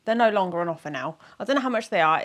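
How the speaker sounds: ordinary delivery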